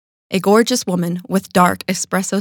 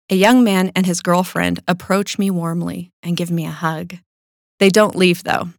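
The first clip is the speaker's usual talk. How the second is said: ordinary delivery, nothing marked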